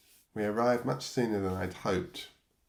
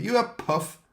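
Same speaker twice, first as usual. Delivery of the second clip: loud, much higher jeering challenge, slow; menacing demand; 13 semitones higher, 8 dB louder than usual. Retold or imitated. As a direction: sneer it at him like the gang's top bully. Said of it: "the most menacing of the boys demanded".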